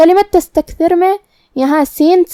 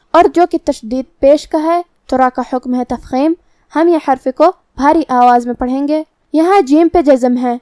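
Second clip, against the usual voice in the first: usual speaking voice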